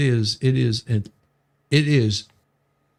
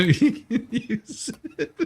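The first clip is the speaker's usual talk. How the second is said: laughing; 11 semitones higher, slower than usual. Real, not acted. Punchline about mouse on window.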